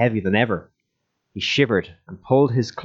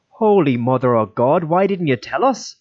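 shocked dismay; 6 semitones higher, 4 dB louder than usual. Acted. Donal sees the bloody feet.

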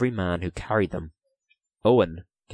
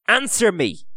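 high, raised-voice command; sharp demand; 12 semitones higher, 4 dB louder than usual. A narrator's reading. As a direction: demand an answer at once. Dillon demands an answer.

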